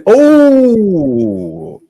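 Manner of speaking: loud, high startled oh; sudden surprise. Real, not acted. Streamer noticing a new caller, loud high surprised oh. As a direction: jolt as something pops up.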